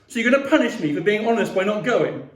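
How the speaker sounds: loud, high-pitched indignant protest; angry yelling. A narrator's reading. Sister protesting to Mum about being punished, yelling. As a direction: yell it with bitter sarcasm. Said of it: "Via yelled at Mum".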